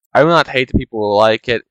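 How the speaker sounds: normal talking voice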